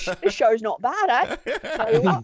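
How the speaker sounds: a hammed-up Australian bloke, pitch jumping up; badly faked Australian, going for it; bad Australian accent. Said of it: "My accents are terrible".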